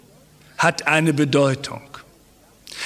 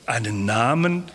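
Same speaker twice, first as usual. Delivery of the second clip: a low, puzzled, sheepish echo of the question; embarrassed; 7 semitones lower, 3 dB quieter than usual. Retold or imitated. Listener caught out by Jehovah's Witnesses, sheepishly repeating the question. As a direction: play caught off guard, unsure. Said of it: "verlegen schauen und sagen".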